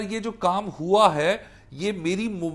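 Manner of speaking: ordinary talk, nothing marked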